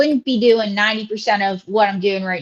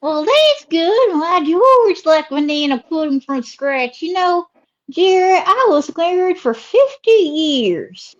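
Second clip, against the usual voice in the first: swooping Southern drawl; Southern lady character; 6 semitones higher, slower than usual. Acted. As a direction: stretch the vowels like a chatty Southern belle. Host voices Ruby.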